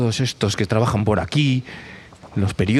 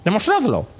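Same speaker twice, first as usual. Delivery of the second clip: very high squeaky hen voice; hens demanding. Acted. Hens challenging Macarena to prove it, high and squeaky. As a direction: squawk the demand.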